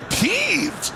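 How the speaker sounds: incredulous echo. Retold or imitated. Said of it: "never heard anybody even use that word".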